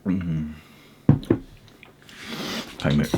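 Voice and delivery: gummy mouth with teeth out; mumbling without dentures; toothless. Real, not acted. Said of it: "Let me take my teeth out".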